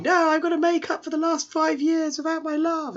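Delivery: very high, hammed-up lovesick cry; squealing declaration of love; mock shouting. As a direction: call out melodramatically on the run. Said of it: "just shouting".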